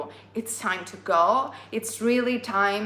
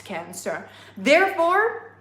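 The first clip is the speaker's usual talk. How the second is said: usual speaking voice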